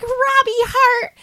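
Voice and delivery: very high gushing granny voice; hammed-up high-pitched adoration; excited old lady. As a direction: gush like a thrilled little granny. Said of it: "so excited about it".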